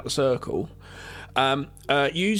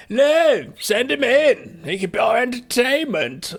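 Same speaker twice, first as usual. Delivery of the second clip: loud, high, drawn-out posh voice; hammed-up haughty toff; posh twat; 9 semitones higher, 5 dB louder, slower than usual. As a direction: call out grandly like a pompous aristocrat. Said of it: "the fucking posh twat".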